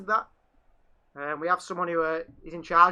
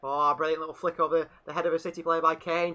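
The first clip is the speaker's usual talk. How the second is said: ordinary talk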